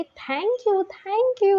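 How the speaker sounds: high squealing thank you; excited thanks. Retold or imitated. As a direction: gush with delight as you hug him. Hugging, thrilled thanks.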